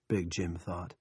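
ordinary delivery

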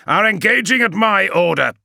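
a fierce warrior announcing attack; fierce pilot on the vox. Acted. Moore answers Org.

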